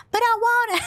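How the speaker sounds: high, childish mock whine; whiny insistence. [name] insisting she wants to help, in a high mock whine.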